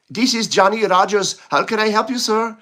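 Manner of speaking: a hint of an Indian call-centre voice; mock Indian accent. Imitating a call-centre agent, light Indian accent. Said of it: "That's more of an Indian accent".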